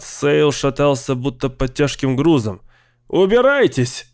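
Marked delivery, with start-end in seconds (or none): 3.1-4.1 high-pitched cry; desperate shout. A narrator's reading. Tormented man banishing the voices, shouting high. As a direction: cry out to drive them off. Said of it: "кричал он".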